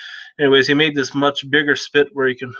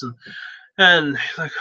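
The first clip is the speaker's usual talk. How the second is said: ordinary delivery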